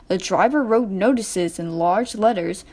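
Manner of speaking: usual speaking voice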